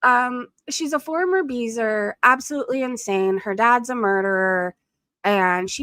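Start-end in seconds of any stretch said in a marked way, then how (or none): none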